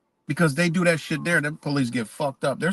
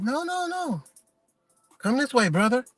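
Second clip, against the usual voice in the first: mock guard, pitched way up and gentle; imitating a friendly guard; 9 semitones higher than usual. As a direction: guide him aside like a kindly guard. Guard redirects him.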